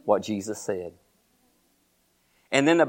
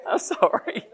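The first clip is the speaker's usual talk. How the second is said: helpless laughter breaking into the words; talking through real laughter; real laughing fit; 8 semitones higher, 4 dB quieter than usual. Real, not acted. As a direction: try to apologise while cracking up. Preacher cracking up at own joke.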